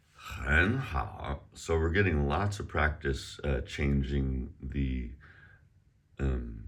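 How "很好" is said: "很好", 很 rising, 好 dipping; third-to-second tone change. Shown as a demonstration. Teacher practicing tone change, "很好" rising then dipping. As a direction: rasp the aspirated h, lift 很, dip 好. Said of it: "third tone to a second tone".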